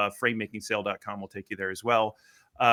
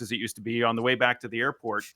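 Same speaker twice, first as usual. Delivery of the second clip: ordinary talk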